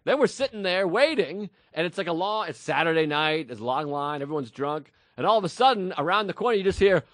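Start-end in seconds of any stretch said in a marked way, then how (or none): none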